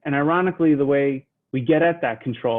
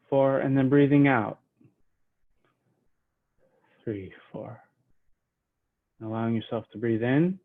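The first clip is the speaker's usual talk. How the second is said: soft guided breathing count, falling away at each exhale; 2 semitones lower, 4 dB quieter than usual. Acted. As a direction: talk them gently through each breath.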